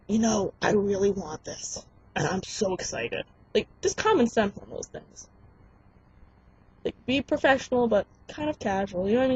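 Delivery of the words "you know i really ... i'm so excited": overdone eagerness. Shown as a demonstration. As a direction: overplay how much you want it. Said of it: "But you don't wanna be like".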